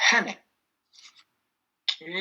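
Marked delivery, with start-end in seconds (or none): 0.1-0.3 "Hene" with the opening H clearly aspirated; sounded H. Shown as a demonstration. Teacher reminding a student to sound the H in "Hene". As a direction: breathe out the H of "Hene", don't drop it. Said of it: "c'est pas le H muet, on le sort".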